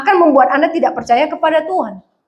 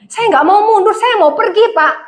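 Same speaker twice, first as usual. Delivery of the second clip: protesting; 6 semitones higher than usual. Retold or imitated. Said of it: "Saya kan pernah bilang".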